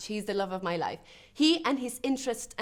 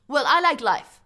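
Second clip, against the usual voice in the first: loud, higher burst of delight; exuberant; 6 semitones higher, 9 dB louder than usual. Acted. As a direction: declare it with gusto. Exuberant declaration of loving life.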